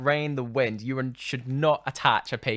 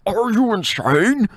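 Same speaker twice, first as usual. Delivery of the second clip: loud, high, drawn-out fake American accent; hammed-up American imitation, stretched and yelled; horrible American accent; 10 semitones higher, 12 dB louder, slower than usual. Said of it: "in that horrible American accent".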